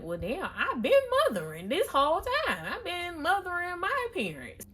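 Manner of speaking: high, animated mock complaint; voiced-out indignant outburst; exasperated realization. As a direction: protest it high, swooping on the stressed words. Acted outburst of realized grievance.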